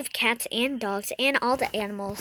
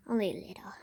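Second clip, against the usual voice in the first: whisper; 3 semitones lower, 7 dB quieter, much faster than usual. Real, not acted. Secretive camera talk.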